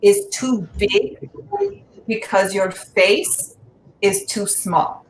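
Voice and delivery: put-on slow, deadpan Persian-accented mom. Mother answering her daughter's nose question in a slow Persian accent. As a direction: deliver it slowly as a blunt Persian mother. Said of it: "Let me ask my mom".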